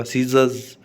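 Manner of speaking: "सीजर्स" as see-zuhz, R left out; silent R. Shown as a demonstration. Teacher proving R wasn't said, "सीजर्स" with silent R. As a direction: say "सीजर्स" slowly clear without any R. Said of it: "आर नहीं बोला".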